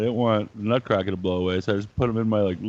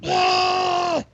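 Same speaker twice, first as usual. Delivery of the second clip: high delighted squeal; 3 dB louder than usual. Retold or imitated. Mock delight at broken toy.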